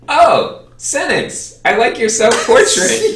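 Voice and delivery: mock-sweet fangirl voice; hammed-up delighted artist; gushing artist. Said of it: "she looks at me and she says".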